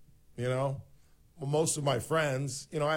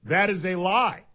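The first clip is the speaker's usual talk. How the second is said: angry; 8 semitones higher, 5 dB louder than usual. Real, not acted. Host cuts off caller's accusation.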